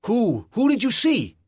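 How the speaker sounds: alarmed guard. A narrator's reading. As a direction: press him for the answer. Guard asking.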